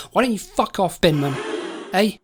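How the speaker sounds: usual speaking voice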